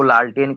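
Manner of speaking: "लालटेन" as a reshaped lantern; village mispronunciation. Shown as a demonstration. Teacher showing unschooled speech, lantern turned into "लालटेन". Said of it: "उसको लालटेन कह देते हैं".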